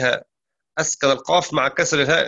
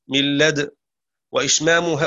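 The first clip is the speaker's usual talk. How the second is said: ordinary talk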